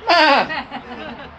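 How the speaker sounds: high, loud comic sheep noise; sheep bleat. Acted. Pastor joking that we are all sheep, comic bleat. Imitates sheep after naming them.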